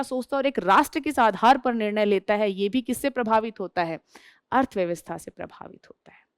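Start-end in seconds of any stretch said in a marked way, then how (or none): 4.5-6.2 breathy stage whisper of the answer. Real, not acted. Teacher whispers the answer to her class. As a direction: let the answer slip out as a secret.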